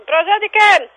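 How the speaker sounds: high, indignant question; real irritation. Real, not acted. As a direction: throw the pleasantry back at them. Rejects the caller's pleasantry.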